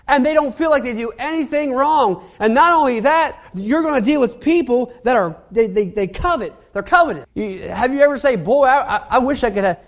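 normal talking voice

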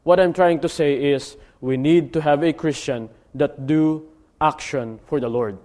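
ordinary delivery

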